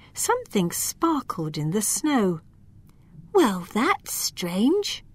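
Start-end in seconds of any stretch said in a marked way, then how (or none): 3.3-5.0 slow, wondering little voice; puzzled elf. Acted. Lila spotting glass in the snow, slow and puzzled. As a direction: muse slowly at the odd sparkle. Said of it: "she thought".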